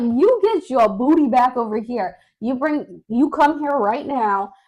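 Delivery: firm retold telling-off; scolding mom. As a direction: act out a mother ordering her child back. Scolding her runaway son, retold.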